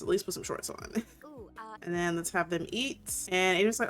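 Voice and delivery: normal talking voice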